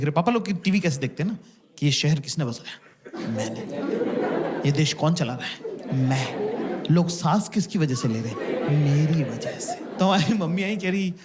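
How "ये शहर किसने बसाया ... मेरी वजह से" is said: lower, self-important dad answering the TV; a hint of a smug father; mock pompous dad. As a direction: puff up like a dad who runs the country. Dad boasting at TV.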